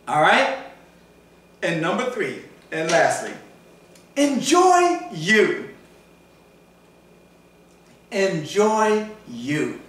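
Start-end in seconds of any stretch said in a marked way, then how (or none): 4.2-9.8 drawn-out emphasis. Real, not acted. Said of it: "preacher repeats the key phrase slowly".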